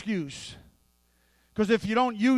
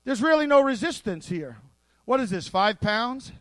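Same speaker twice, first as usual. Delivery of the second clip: usual speaking voice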